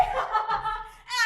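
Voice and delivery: loud giddy laughter; joyful laugh. Acted. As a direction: laugh as if beside yourself with joy. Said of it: "off my balance with joy".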